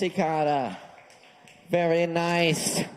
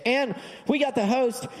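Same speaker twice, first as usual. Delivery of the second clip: hyped-up intro; 7 semitones higher than usual. Real, not acted. Host shouting the comic's name to the crowd, rising hype. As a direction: whip the crowd up for the next act.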